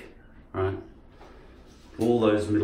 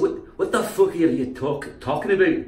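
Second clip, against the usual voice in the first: higher mock Scottish swearing; Scottish accent; 5 semitones higher than usual. Speaker challenging someone's talk, Scottish accent. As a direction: query it in a broad Scottish accent. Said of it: "sound a bit like Scottish".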